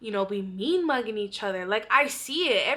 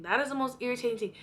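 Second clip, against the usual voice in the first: ordinary talk, nothing marked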